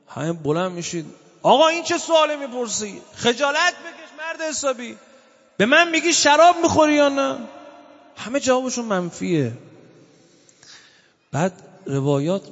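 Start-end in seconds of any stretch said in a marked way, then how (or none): 1.4-7.4 offended outburst. Retold or imitated. To a man asking if he drinks wine, indignant protest. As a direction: bristle at the insult and let it grow.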